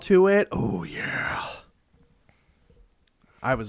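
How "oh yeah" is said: hushed conspiratorial whisper. Real, not acted. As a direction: breathe it out secretly. Whispered agreement about Fireball.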